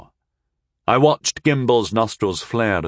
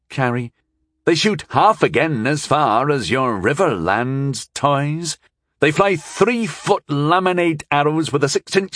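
higher-pitched, proudly emphatic lady; proud dig, pitched up and unhesitating; proud retort; 6 semitones higher than usual. A narrator's reading. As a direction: boast of your people with supreme confidence. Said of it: "but she said it proudly".

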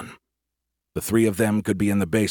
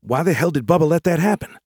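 high-pitched upset question; alarmed disbelief; 8 semitones higher, 4 dB louder than usual. Acted. Man questioning AI about the attack, alarmed. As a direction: demand it, pitch shooting up. Startled by news of lost destroyers.

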